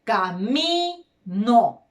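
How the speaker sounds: camino said slowly, MI held long; slow, syllable-by-syllable camino, weight on MI; stress on penultimate syllable. Shown as a demonstration. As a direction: stretch the middle syllable of camino. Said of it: "en la penúltima sílaba tengo las palabras".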